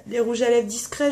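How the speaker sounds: usual speaking voice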